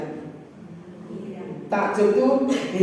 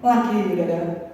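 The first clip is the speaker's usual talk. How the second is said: ordinary talk, nothing marked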